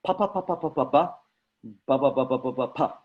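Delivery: crisp popping pa and ba syllables; a teacher's plosive warm-up, pa pa pa then ba ba ba; plosive drill. Shown as a demonstration. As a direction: pop each "pa" and "ba" off the lips. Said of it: "So one more time, it goes pa".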